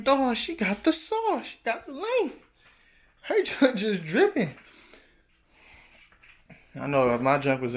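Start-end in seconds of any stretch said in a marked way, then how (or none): 0.0-4.5 high, drawn-out gushing over her hair; excited hyping. Retold or imitated. Vlogger gushing over a girl's braids, excited and high. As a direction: hype her up in a high, stretched-out squeal.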